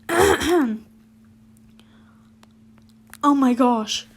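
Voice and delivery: loud shocked cry; shouted. A narrator's reading. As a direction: burst it out at the letter. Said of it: "I shouted".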